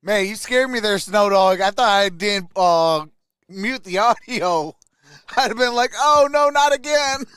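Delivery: ordinary delivery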